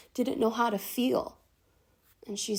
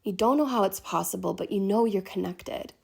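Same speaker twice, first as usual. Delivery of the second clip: ordinary delivery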